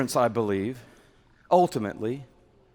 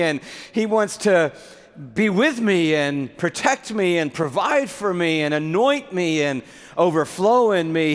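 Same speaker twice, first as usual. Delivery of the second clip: gushing excitement; 4 semitones higher than usual. Acted. Preacher mocking a busy believer at God's table, hammed-up gushing. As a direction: play an overeager guest thrilled at everything. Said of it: "So we would go".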